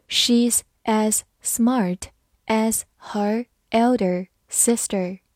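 slow read. Shown as a demonstration. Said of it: "好，慢慢来读".

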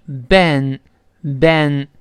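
ben, ben, drawn out very slowly; ben, ben, the e gliding into n; ben, ben, a slow teaching model; slow syllable demo. Shown as a demonstration. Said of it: "所以是ben".

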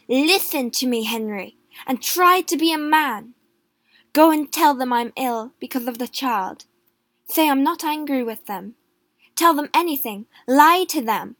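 a queen taking charge of a coward; wife ordering her husband, hard and loud; firm command. A narrator's reading.

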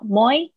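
teacher drilling the first syllable. Shown as a demonstration. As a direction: isolate the syllable "Moi" and hit it clearly. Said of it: "一个一个音节把它念好".

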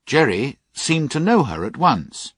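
ordinary delivery, nothing marked

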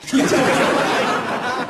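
airy giggle; wheezing high laugh; high breathy laugh. Real, not acted. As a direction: giggle helplessly. Laughs at own joke.

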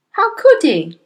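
high, loud dismissal; scornful retort. A narrator's reading. Wife dismissing daughter's swollen head idea, scornful. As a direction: shoot down a silly idea.

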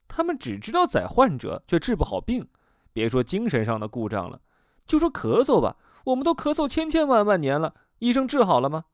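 high, worked-up complaint with pitch leaping up; indignant patient. Acted. Patient griping to the therapist about doctors, high-pitched indignation.

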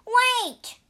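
high, loud shout of alarm; crying out. A narrator's reading. Bajie as the priests lock the door, crying out. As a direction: shout to stop them. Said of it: "cried Bajie".

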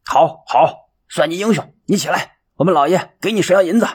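steward coaxing. A narrator's reading. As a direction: coax him up through clenched teeth.

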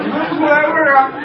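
high, loud exclaimed punchline. Acted. The nose shouts the punchline at the head.

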